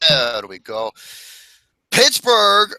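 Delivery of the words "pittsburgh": hyped announcer. Acted. Hyped team name announcement.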